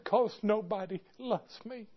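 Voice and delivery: small, very soft voice; hushed, understated sadness in a retold reply; retold tearful child. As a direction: lightly voice a hurt little boy. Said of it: "he started crying".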